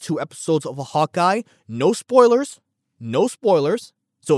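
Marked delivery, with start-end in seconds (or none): 1.7-2.6 high, loud, eager insistence; excited promise. Real, not acted. Host reassuring listeners about Hawkeye, high and excited. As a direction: blurt it out with a jump in the voice.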